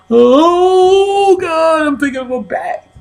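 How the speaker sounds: very high wail, loud at the start; hammed-up high-pitched distress; mock agony. Man failing to relax with his partner, high agonised wail. Acted distress in bedroom scene.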